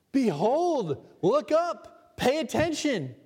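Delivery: rising, urgent divine summons; voicing God's call. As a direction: call out like God demanding attention. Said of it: "God is saying".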